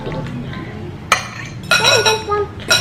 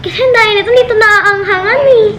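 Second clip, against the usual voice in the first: loud; 6 semitones higher, 6 dB louder, faster than usual. Real, not acted. Reacting loudly to amount.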